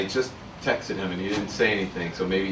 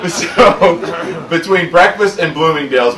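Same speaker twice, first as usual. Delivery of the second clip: laughing through the words, slow; words spilling out mid-laugh; a loud real laugh carrying the line; 7 semitones higher, 10 dB louder, slower than usual. Real, not acted. Laughing at breakfast-to-Bloomingdale's leap.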